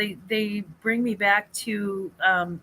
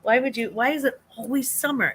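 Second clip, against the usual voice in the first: exasperated; 4 semitones higher than usual. Retold or imitated. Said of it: "I'm like".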